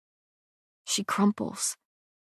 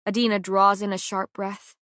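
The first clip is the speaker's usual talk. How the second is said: usual speaking voice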